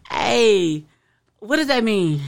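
ordinary delivery

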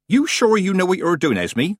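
high, loud Nanny figure; doubting old witch. A narrator's reading. Nanny Ogg questions Granny.

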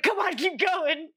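high, drawn-out plea; frantic urging. Retold or imitated. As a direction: beg in a mock-desperate voice. Acts urging brother to continue CPR.

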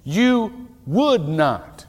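"would" drawn out and leaned on, very slow; stress on would. Shown as a demonstration. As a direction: slowly press "would", not "could". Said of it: "Not you could not".

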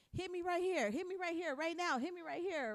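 mock tough-guy taunt; taunting challenge. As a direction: egg him on to hit you. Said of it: "Angel's like".

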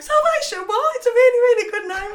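hammed-up offended squeal, rushing through; mock outrage. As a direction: play the indignant version of yourself defending the name. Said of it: "And I was like".

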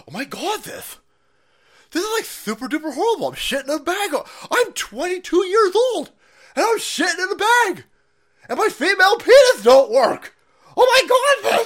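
high, loud mocking whine of a regretful young person; mocking whiny voice. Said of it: "you're gonna be like".

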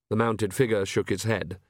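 usual speaking voice

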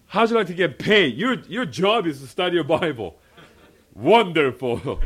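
high, loud, exuberant pitch to the crowd; excited preacher at full lift; delighted amazement. Real, not acted. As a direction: sell it to the congregation with glee. Selling his dream job to congregation.

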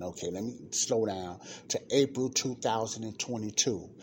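ordinary talk, nothing marked

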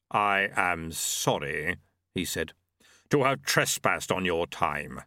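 low, slow and grave; slow, solemn Death speaking. A narrator's reading. Death takes his leave.

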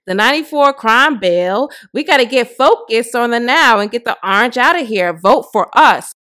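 loud, slow mocking quote; hammed-up imitation of her post. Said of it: "she said".

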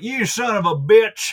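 high, loud, drawn-out Southern holler; caricatured Southern accent. Retold or imitated. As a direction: ham up an outraged Southerner. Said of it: "Not all Southerners sound like".

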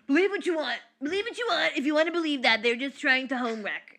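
mother imitating the father's protest; dad's defensive voice. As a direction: ham up the dad brushing it off. Mom voicing dad's denial.

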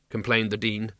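ordinary delivery

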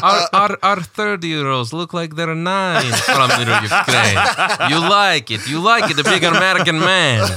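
mock Ukrainian pimp accent, laughing; a hammed-up Eastern European seller. Mock Ukrainian accent pitching to a big American man. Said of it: "laughing at the Ukrainian accent".

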